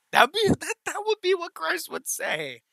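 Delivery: excited point. Real, not acted. Excited point about the character.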